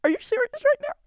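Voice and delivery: high, loud, stammering outrage; outraged disbelief. Acted. Outraged at the man's behaviour.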